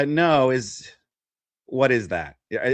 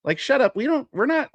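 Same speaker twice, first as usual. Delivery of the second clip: high, scornful "shut up"; fed-up mock retort, pitched high; exasperated retort; 12 semitones higher than usual. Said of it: "And it's like".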